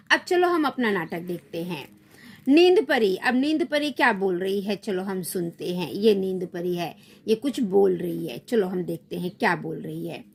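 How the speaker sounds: ordinary delivery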